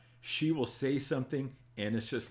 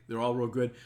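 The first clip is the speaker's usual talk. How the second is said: normal talking voice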